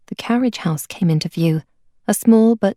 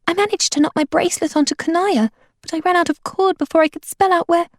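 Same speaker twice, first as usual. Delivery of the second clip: put-on higher girl's voice, rushing to explain; Abeka's voice; 8 semitones higher than usual. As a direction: voice Abeka, eager from inside the trunk. Abeka's voice, held by narrator.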